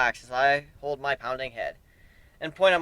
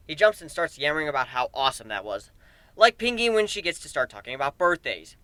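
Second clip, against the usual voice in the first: usual speaking voice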